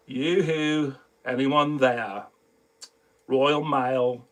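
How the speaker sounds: imitating a friend's unwilling read, low and soft; low, drawn-out, unenthusiastic delivery; reluctant reading. Friend made to voice a script for her, reluctant reading. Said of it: "that's the way he was reading it".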